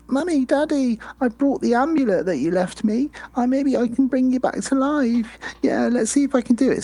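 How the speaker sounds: put-on high, eager little-boy voice; hopeful child pleading with his parents; Glenn's childlike voice. Doll talking to his lifeless parents, high and hopeful. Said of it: "and he says".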